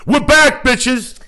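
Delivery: high, loud show-opening yell; loud excited opener; hype shout. Real, not acted. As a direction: bellow it like a host kicking off.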